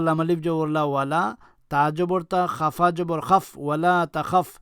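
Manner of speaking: ordinary talk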